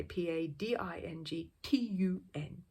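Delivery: slow spelling of "P A D I N G T U N", each letter separate; spelled out letter by letter. A narrator's reading. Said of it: "in his usual way".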